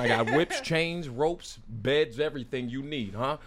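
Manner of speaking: ordinary talk, nothing marked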